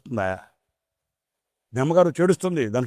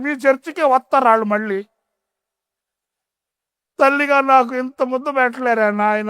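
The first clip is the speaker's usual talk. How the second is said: put-on much higher indignant old woman's outburst; angry old mother; 10 semitones higher, 3 dB louder than usual. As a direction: scold the pastor like a wronged old mother. Old mother scolds the pastor.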